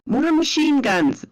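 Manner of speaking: high, urgent soldier's call; narrated yell. A narrator's reading. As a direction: yell it across to a mate in the trench. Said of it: "Bill yelled to Tom".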